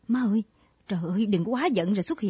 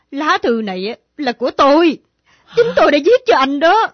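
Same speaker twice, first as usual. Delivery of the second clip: loud confession; 3 semitones higher, 9 dB louder than usual. Acted. Confessing she wrote the letter.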